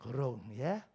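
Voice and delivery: ordinary delivery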